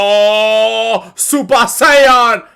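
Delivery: loud, very slow drawn-out dub line; Goku Spanish dub. Pretend. Friend mocking Goku's Spanish dub, loud and slow. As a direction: stretch it out like a dramatic transformation.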